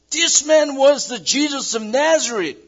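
high, loud accusing cry; public accusation. Acted. Slave girl to the crowd, a high loud accusing cry. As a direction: call him out to the whole courtyard.

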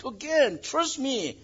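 high, desperate swearing voice; swearing denial. Acted. Peter cursing before the crowd, high and desperate. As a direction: swear it out in panic. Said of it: "to swear".

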